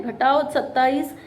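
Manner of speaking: usual speaking voice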